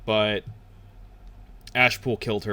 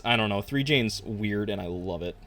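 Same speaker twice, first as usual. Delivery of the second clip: usual speaking voice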